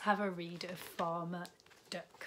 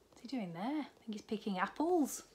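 usual speaking voice

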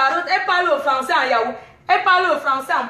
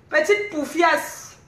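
Petite poufiasse dragged out slowly; slow mocking insult; much slower than usual. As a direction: linger on petite to sneer. (insulte traînée lentement)

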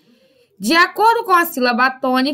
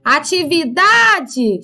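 "Atividade" called out slowly, DA stretched; slow "AtiviDAAde" with stress on DA; calling the word; much slower than usual. Shown as a demonstration. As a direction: call "Atividade", leaning long on DA. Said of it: "chama a palavra, ó, o da é mais forte".

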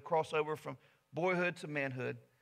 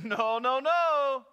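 louder, higher no's building up; firm refusal; 9 semitones higher, 9 dB louder, much slower than usual. Acted. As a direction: push the snake away with each no. Warrior refuses the snake.

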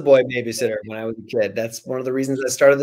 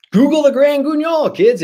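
excited urging; 12 semitones higher, 5 dB louder than usual. Real, not acted. Author urging kid listeners, excited and high. Author hypes kids to look it up.

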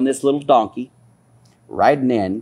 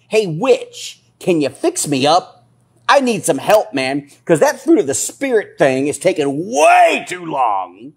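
higher-pitched mocking impression of a backslider; mock seeker's plea; 7 semitones higher, 4 dB louder, slower than usual. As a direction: lightly act an impatient believer begging a witch. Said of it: "you know, like Saul did".

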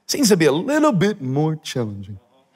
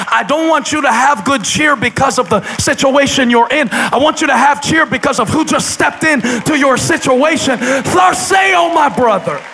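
loud high impassioned preaching over music; full-force preaching; 9 semitones higher, 5 dB louder than usual. Acted. Pastor preaching to the congregation at full force over music. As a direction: drive it home with rising fervor.

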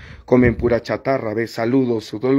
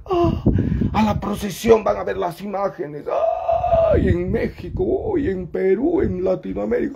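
mocking pious churchgoer; 7 semitones higher than usual.

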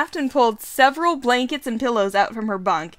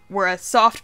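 plain reading voice

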